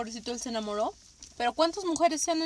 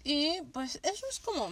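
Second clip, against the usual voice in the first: ordinary talk, nothing marked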